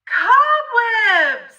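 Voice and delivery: startled cry. A narrator's reading. Reading to a child, finding cobwebs: startled cry. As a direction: cry out at the sticky webs. Scary discovery in story.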